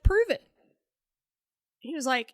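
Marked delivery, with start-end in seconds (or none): 0.0-0.4 high, loud retold dare; defiant dare, pitched right up; forceful challenge. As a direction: throw it out loud and cocky.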